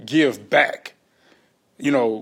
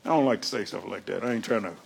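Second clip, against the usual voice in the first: much softer, lower confession; hushed aside; 8 semitones lower, 9 dB quieter, faster than usual. Real, not acted. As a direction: drop your voice, reluctant to say it. Confiding aside about violence.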